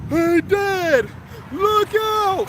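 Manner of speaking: high, loud yell of look out; a son's high panicked call to his dad; yelled warning. Retold or imitated. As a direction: shout a warning down the hill. Son yelling down the slope.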